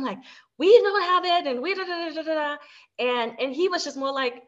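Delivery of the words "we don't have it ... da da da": higher, louder mock-angry outburst, then trailing into da-da; snapping at her husband over money, then mumbling it off; mad retort. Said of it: "I would just be mad".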